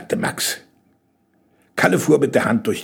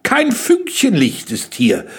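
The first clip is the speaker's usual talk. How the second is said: high, slow, emphatic objection; stretched-out, high contradiction; contradicting; 9 semitones higher than usual. A narrator's reading. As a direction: object as the boy, pitched up. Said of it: "widersprach Max".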